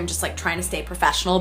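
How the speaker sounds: ordinary delivery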